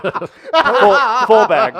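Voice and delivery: loud, high and laughing; imitating Southern sweet tea lover. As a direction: belt out full bag with glee. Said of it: "He's like".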